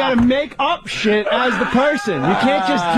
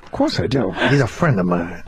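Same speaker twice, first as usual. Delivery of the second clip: low mock boast; 14 semitones lower than usual. Voicing Pacino story character.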